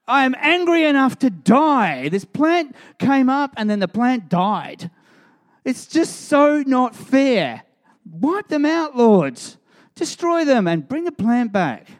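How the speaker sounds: put-on high, loud whiny child throwing a fit; toddler tantrum. Preacher plays Jonah demanding God destroy the city, high whiny tantrum. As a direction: wail it like a three-year-old denied a toy.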